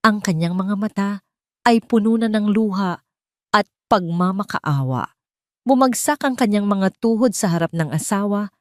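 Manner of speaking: usual speaking voice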